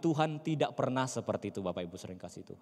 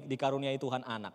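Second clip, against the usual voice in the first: ordinary talk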